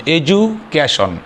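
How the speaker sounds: Education said slowly, broken into parts; syllable breakdown. Shown as a demonstration. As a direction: draw out education part by part with a pause inside. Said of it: "উচ্চারণ করতে তিনবার সময় লাগবে". (Education ধীরে ধীরে ভেঙে ভেঙে উচ্চারণ)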